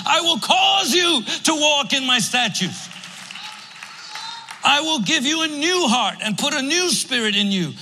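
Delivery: high, loud, driving sermon cadence; full-force preaching. Acted. Pastor preaching at full force to the congregation, high and loud.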